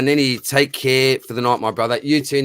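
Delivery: ordinary delivery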